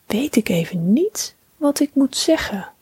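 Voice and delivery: ordinary delivery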